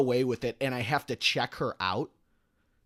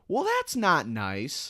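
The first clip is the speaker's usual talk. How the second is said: prim scolding; 7 semitones higher, 3 dB louder, much slower than usual. Retold or imitated. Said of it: "turns around and goes".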